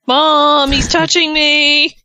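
high, loud, whiny tattle; whiny tattling little sibling. Retold or imitated. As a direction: whine it like a bratty kid. Mocking Johnny as tattling kid.

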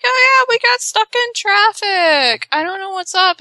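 high-pitched, overly casual excuse; feigned innocence. Retold or imitated. Husband covering for being five hours late, feigned innocence. Husband lying to wife about lateness.

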